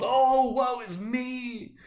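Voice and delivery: very high, slow wail; wailing lament. A narrator's reading. Ghost wails its doom to Scrooge, high and slow. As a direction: moan it like a doomed soul.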